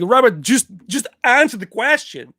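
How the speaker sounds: mock pleading tough guy in a foreign accent; Van Damme impression. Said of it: "I'll do it in Van Damme's voice".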